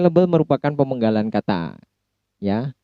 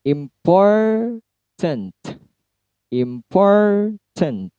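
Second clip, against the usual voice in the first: Important, POR high and held long; careful demo of word stress on Important; 8 semitones higher, much slower than usual. Shown as a demonstration. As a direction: say "Important" slowly with POR lifted. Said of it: "Jadinya adalah seperti ini".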